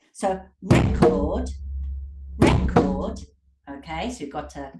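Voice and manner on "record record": loud, slow RE-cord; RECord as a noun, punched; stress on first syllable. Shown as a demonstration. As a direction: beat out RE in record. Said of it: "is a noun".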